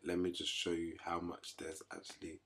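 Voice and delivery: hushed whisper, breath only. Real, not acted. Whispering to viewers while showing his empty kitchen.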